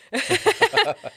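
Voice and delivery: loud, high-pitched laugh; real laugh. Real, not acted. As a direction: burst out laughing at your own joke. Laughs after joking to baby.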